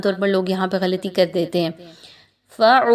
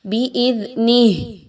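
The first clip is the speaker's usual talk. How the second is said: recitation; 2 semitones higher, slower than usual. Shown as a demonstration. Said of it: "teacher reciting the word".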